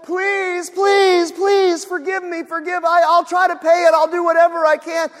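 loud, high desperate pleading; a servant on his knees begging the master, loud and high. Acted. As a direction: beg for mercy at the top of your voice. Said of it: "he begged".

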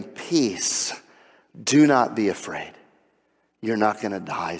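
soft, hushed and calming; quiet the man's fear tenderly; gentle reassurance. Retold or imitated. The Lord calming frightened Gideon, soft reassuring voice.

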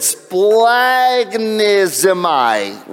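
splagchnizomai drawn out very slowly; struggling pronunciation. Real, not acted. Preacher attempting a Greek word he can't say, struggling pronunciation. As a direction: sound out splagchnizomai slowly, unsure it is right. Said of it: "I can't even say the name of it".

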